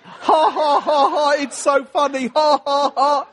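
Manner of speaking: loud, high mock guffaws; hammed-up hysterical laughter around the words; imitated laughing. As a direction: mimic people cracking up, loud and high. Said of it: "they started laughing".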